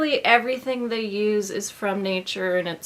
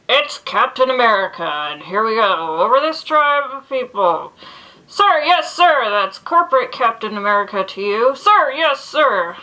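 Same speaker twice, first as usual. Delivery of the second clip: loud, high, gung-ho military radio bark; booming pilot voice; 3 semitones higher, 11 dB louder than usual. Pretend. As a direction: shout it like an over-the-top corporate American captain. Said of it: "I have this imagined voice in my hair".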